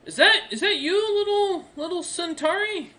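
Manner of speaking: put-on high-pitched young soldier voice. Young soldier spotting the party's little Centauri, high-pitched surprise. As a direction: greet them in a high, curious young man's voice. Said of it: "the young man kinda looks over".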